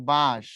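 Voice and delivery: বাঁশ with the vowel pushed through the nose; nasalised vowel. Shown as a demonstration. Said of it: "নাকের উপরে চাপ দিয়ে উচ্চারণ করতে হয়". (বাঁশ নাকি সুরে, নাকে চাপ দিয়ে)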